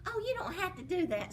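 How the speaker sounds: put-on high, quick coaxing servant girl. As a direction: soothe the giant quickly. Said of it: "said the servant girl".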